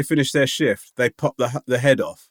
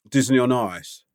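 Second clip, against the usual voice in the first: usual speaking voice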